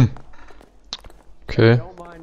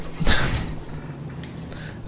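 soft, voiceless laugh; 9 dB quieter than usual. Real, not acted. Let's Player shocked at the time, soft laugh. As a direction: laugh quietly on the breath.

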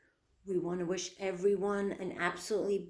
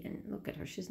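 whispered aside; 9 dB quieter, faster than usual. Real, not acted.

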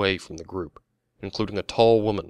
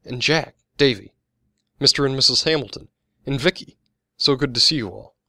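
higher, louder happy hello; delighted greeting; 6 semitones higher, 7 dB louder than usual. A narrator's reading. Soldier greeting the old neighborhood group, delighted. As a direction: greet each old friend with raised energy.

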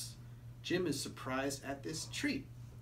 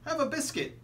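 friendly boss voice; 9 semitones higher, 8 dB louder than usual. Acted.

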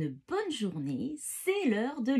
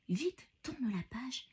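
ordinary delivery

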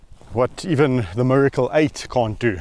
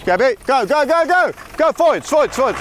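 much higher, louder urgent cheering; egging the lioness on, go go go; high, loud rapid urging; excited urging; 13 semitones higher, 4 dB louder, faster than usual. Real, not acted. Urging the lion on.

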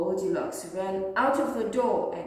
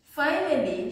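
ordinary delivery, nothing marked

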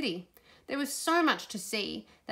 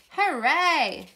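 loud, high hooray; big drawn-out cheer; 7 semitones higher, 8 dB louder than usual. A narrator's reading. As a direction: cheer in triumph. Bus gets across in story.

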